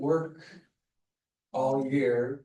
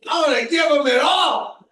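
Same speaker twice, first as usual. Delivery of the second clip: retold furious outburst; 12 semitones higher, 11 dB louder than usual. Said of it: "And I said".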